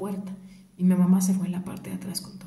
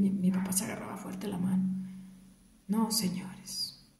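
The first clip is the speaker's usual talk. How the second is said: usual speaking voice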